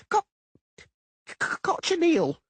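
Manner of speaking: high halfling stammering out the word; stuttering. Acted. Doppler stammering his answer to the merchant. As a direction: stammer hard before blurting it high. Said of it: "the Doppler stuttered".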